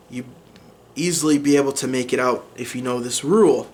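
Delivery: ordinary talk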